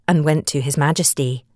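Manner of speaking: ordinary delivery, nothing marked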